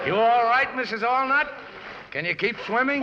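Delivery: high, loud call across the water. Acted. Charlie calling to his wife in the lake, breathless. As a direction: shout to her while swimming.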